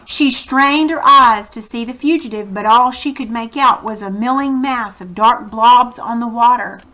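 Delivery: reading a chapter aloud to the class; teacher narrating a children's novel; story narration. A narrator's reading. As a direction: tell the story to the kids, steady and clear.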